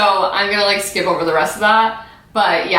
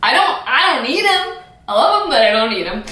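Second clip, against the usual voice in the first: sassy diva; 6 semitones higher than usual. Pretend. Sassy repeat.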